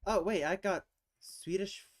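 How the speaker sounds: sudden high, loud "oh, wait"; excited realization. Real, not acted. Solving a word puzzle, the answer clicks: excited burst.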